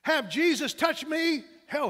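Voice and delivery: loud, high, indignant outburst; angry scorn. Acted. Wounded Malchus scorning the healing hand, angry outburst. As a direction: throw it out slowly in loud disbelief. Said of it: "he hissed".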